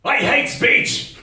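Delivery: high, loud, slow outburst; mock angry shout. End of row with pushy friend, shouted. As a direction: stretch out a hammed-up furious yell. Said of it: "when I shouted that".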